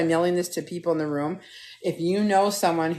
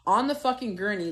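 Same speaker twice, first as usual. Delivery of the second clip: ordinary talk, nothing marked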